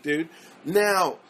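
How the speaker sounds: ordinary talk, nothing marked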